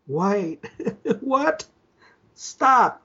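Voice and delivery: laugh, then squeak out your disbelief. Acted. Stunned caller hears the man he sought, laughing disbelief. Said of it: "shook my head in disbelief".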